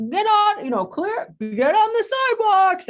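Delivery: high, loud mock shout to clear the way; staff shouting. Park staff clearing the street for a parade, loud high call. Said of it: "all of a sudden they're like".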